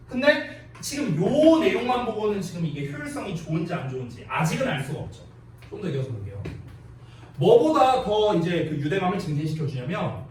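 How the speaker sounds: ordinary talk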